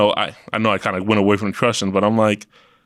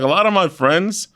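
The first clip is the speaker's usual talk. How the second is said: ordinary talk, nothing marked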